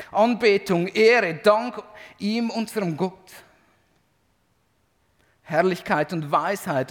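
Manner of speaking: loud, high, proclaiming reading of the heavenly praise; scripture reading. Acted. Preacher reading Revelation to congregation, loud proclaiming voice. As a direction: read the vision as if shouting praise with the crowd. Reads Revelation aloud with fervor.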